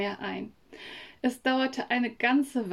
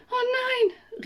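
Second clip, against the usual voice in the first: erschrocken; 14 semitones higher than usual. Acted. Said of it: "rief Mia".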